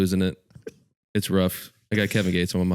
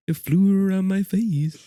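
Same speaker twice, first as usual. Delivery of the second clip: high mock-delighted voice; childlike wonder; 11 semitones higher than usual. Playing the rapper thrilled by a butterfly, in childlike wonder. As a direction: marvel in a high put-on kid's voice.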